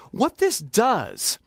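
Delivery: ordinary delivery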